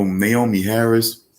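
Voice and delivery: normal talking voice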